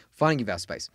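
usual speaking voice

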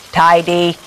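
a machine's flat command; robot announcing its single purpose. A narrator's reading. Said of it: "said the Wendell Bot".

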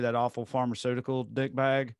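normal talking voice

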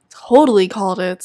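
ordinary delivery

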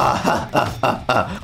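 low villain laugh; deep mock-evil chuckle. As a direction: gloat with a slow 'ha ha ha'. Villain principal gloats after threat.

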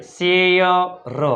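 slow, split-up સે ય ર; syllable-by-syllable demo. Shown as a demonstration. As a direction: slowly sound out સે ય ર. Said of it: "એસ એચ એટલે સે".

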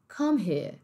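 British come here, R dropped; British accent. Shown as a demonstration. Teacher repeating the phrase, British accent. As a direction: let here trail into a vowel, British-style. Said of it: "como se você simplesmente ignorasse essas duas letras".

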